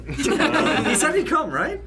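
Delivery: high, slow, laughing. Real, not acted. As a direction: laugh it out, drawn slowly. Laughing explanation.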